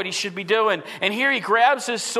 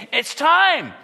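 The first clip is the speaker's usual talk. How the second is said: raised, slow, emphatic rally; eager rallying cry; 8 semitones higher, 6 dB louder than usual. Acted. Disciples ready to pull their swords, eager rallying cry. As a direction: draw it out as a battle cry.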